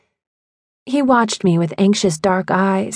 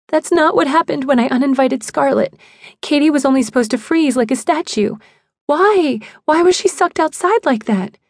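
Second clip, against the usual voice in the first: high, tearful pleading; distressed protest; 6 semitones higher than usual. A narrator's reading. Sobbing narrator protesting to Phil, high and distressed. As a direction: plead upward, building higher on the questions.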